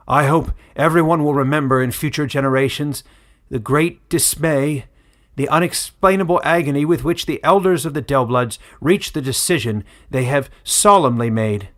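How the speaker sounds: put-on loud, grave old village elder addressing the crowd; solemn elder's address. Old elder announcing the verdict to villagers, loud and grave. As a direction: proclaim it like a weary old elder passing judgment.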